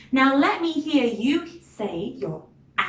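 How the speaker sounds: ordinary delivery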